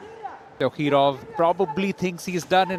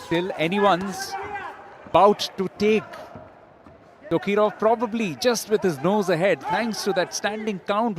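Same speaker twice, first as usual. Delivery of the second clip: late-fight commentary climbing in pitch; rising excitement; 7 semitones higher than usual. Real, not acted. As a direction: build the tension into the final minute. Last minute of close bout.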